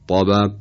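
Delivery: emphatic, dark T in «طبق»; تلفظ درست ط. Shown as a demonstration. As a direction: correct «طبق» with a heavy velarized ط. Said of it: "درستش هست". («طبق» با طای پرحجم و مُطبَق)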